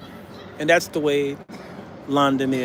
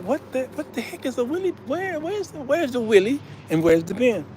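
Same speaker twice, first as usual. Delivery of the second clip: quiet, puzzled high questions; bewildered by the word wheelie bin; baffled; 7 semitones higher, 6 dB quieter than usual. Real, not acted. An American puzzling over a British bin.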